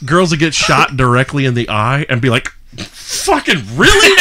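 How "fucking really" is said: high, drawn-out incredulous imitation; mock disbelief. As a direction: act out exasperated disbelief, slowly. Acting out indignant porn actress.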